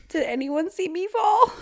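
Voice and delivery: high-pitched whimper breaking into laughter; mock whimper. Undignified gargoyle asks after his fall, mock whimpering. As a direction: play a wounded whimper, pitch climbing high. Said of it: "Let out a whimper".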